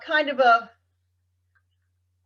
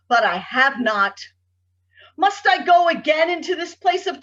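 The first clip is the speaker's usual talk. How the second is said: dramatic verse, building higher and louder; anguished poem recitation; 5 semitones higher, 3 dB louder than usual. Acted. Poet addresses a tormentor that clings like a leech, anguished recitation. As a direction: drive the lines up into a forceful curse.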